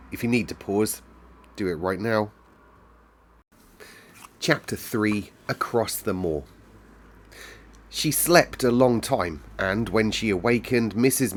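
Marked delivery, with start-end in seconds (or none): none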